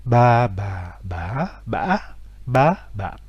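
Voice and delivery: ba répété sur les six tons; enchaîner les six ba; six tones in a row. Shown as a demonstration. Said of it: "sentir la différence dans la prononciation". (les six tons de ba enchaînés)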